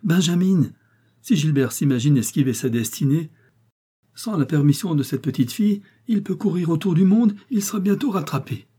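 high, lively exclamations; joyful old lady. A narrator's reading. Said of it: "jura joyeusement la vieille dame".

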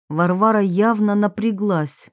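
usual speaking voice

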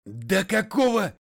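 loud, high, drawn-out protest; outraged. A narrator's reading. McGray trying to protest, outraged. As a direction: explode in indignation.

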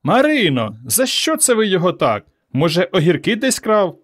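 high, inquisitive calling; neighbours calling out. A narrator's reading. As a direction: call your question over a fence. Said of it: "Сусіди з-за тинів кричали".

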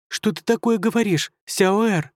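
high, scolding little fairy. A narrator's reading. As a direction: tug at him with a squeaky scold.

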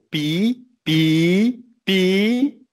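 same syllable "pi" at different pitches, the last rising high; demonstração de tons. Shown as a demonstration. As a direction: say "pi" three times, each on a new tone, ending high. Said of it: "quando a palavra muda de sentido de acordo com a altura". ("pi" em tons diferentes, o último subindo agudo)

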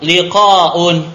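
loud, measured vocabulary drill; model reading. Shown as a demonstration. Teacher reading new words for the class, loud drill voice. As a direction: call it out for the class to echo. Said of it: "Naqra maratan ukhra".

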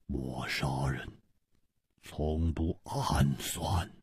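very low, soft, slow menace; cold killer. A narrator's reading. Killer offers to kill the man once called out, coldly. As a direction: murmur each phrase low with long pauses. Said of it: "易点红冷冷地说".

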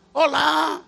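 ordinary talk

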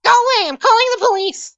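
high, agitated character voice; voice from inside. Acted. Resident threatening to call police on intruders, high agitated voice. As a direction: warn them off in a high-pitched voice. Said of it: "you hear from the inside".

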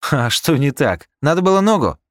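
high, loud sarcastic jab; sarcastic taunt. Acted. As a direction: needle him sarcastically. Rika mocks injured crewman.

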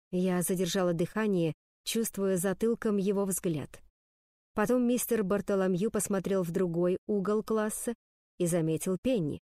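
plain reading voice

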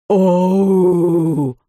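long, loud wail of pain; drawn-out pained wail; anguished howl. A narrator's reading. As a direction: howl out a stretched "oh". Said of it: "не своим голосом взвыл мистер Лич".

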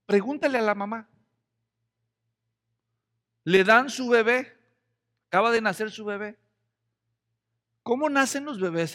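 ordinary talk

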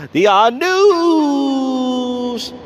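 high, long-held "news" like a showman; drawn-out segment announcement. Pretend. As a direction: announce it with a huge stretched final word. Announcing the next segment with flourish.

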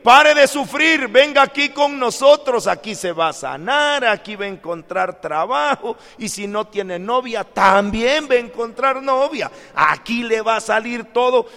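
high, loud promotional pitch; imitated rousing call to the suffering; healing-campaign preacher. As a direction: sell miracles like a revival pitchman. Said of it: "cuando oímos decir".

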